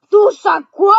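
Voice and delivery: loud, high outraged shout; furious shout. A narrator's reading. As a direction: bellow it in disbelief. Hagrid bursting out.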